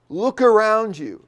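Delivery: a sudden high, loud command to look around; emphatic command. Shown as a demonstration. Teacher rendering the Greek imperative to students as an emphatic command. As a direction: bark it as an urgent order to stay alert. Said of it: "I would say very emphatic".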